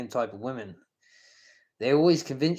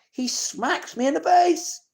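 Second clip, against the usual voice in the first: high, loud, heated outburst; furious rant; 13 semitones higher, 5 dB louder than usual. Real, not acted. Calling out Sam on video for the car crash, furious and loud. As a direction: let the anger boil over as you tell it.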